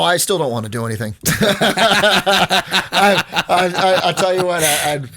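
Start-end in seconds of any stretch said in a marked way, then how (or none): 1.3-5.0 high, loud rueful laugh through the words. Real, not acted. Answering the host with a loud rueful laugh. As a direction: laugh it off, bitterly amused. Laughs at still not wanting.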